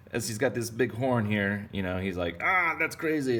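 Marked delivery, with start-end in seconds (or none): 2.4-3.4 high, loud ah; fish exclaiming. Pretend. Horned fish speaks.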